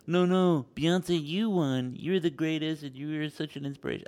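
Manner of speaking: a hint of a star sweetly gushing over a rival. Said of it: "You're doing this [name] thing".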